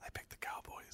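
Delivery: secretive whisper, no voice at all. Retold or imitated. Telling the Eagles-fan doorman his Super Bowl pick, whispered. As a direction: breathe it like a confession. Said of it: "I go".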